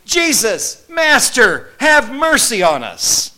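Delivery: high, loud plea shouted from a distance; desperate yelling. Retold or imitated. Lepers calling to Jesus from afar, loud desperate yell. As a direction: cry out for mercy with all your might. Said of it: "they were yelling with all of their might".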